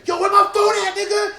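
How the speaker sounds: high, loud demand; mock accusing shout. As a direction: imitate an outraged person yelling out an accusation. Acting out someone accusing another person.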